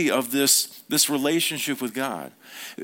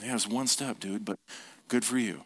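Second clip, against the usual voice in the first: softer, lower half-hearted congratulations; underwhelmed; 5 semitones lower, 7 dB quieter than usual. Acted. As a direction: mutter an unimpressed aside. Unimpressed friend's inner response.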